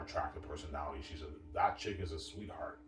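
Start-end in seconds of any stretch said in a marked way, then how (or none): none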